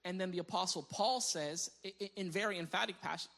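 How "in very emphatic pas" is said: ordinary talk, nothing marked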